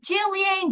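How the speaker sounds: Ghislaine said as JILL-ee-ain; alternative pronunciation. Shown as a demonstration. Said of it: "Everyone pronounces her name differently".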